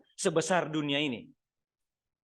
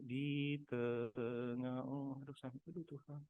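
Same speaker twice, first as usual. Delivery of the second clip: soft, broken singing sliding into groans; moaning song; 4 semitones lower, 15 dB quieter, slower than usual. Shown as a demonstration. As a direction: sing weakly and break into sighs. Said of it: "Baru nyanyi". (nyanyian lirih yang terputus oleh keluhan)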